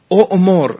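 اؤمر with the full hamza. Shown as a demonstration. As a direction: punch the hamza at the start of اؤمر. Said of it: "همزه رو بردارید".

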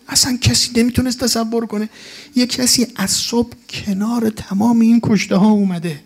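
ordinary talk

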